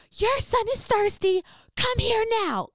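very high, loud drawn-out scream; screaming like a maniac. Retold or imitated. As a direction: scream across a park to embarrass him. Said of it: "So I start screaming".

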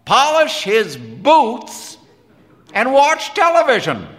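loud, high-pitched rant; pushed-up, scornful complaining voice; exasperated Quinn. Acted. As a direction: list his habits with mounting exasperation.

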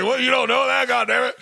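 hammed-up grandparent scolding a grandkid; mock scolding grandparent. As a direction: snap at the kid like a grumpy grandparent. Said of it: "They're like".

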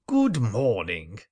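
slow, languid upper-class Kensington greeting; a lightly voiced Kensington toff, slow and unhurried; Kensingtonian accent. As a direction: drawl it like a lofty Kensington aristocrat. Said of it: "with an accent more Kensingtonian".

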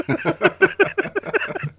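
squealing high laugh; high giggle. Real, not acted. Laughing at his own starstruck moment, high giggle.

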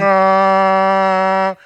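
long loud held ah like a car horn; horn blare imitation. Retelling the driver who kept honking, loud held horn sound. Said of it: "he kept on going".

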